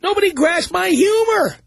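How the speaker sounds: lightly voiced indignant comedian; mock Michael Richards. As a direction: play the comic shrugging it all off, mockingly. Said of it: "Why didn't Michael Richards put out a statement like that".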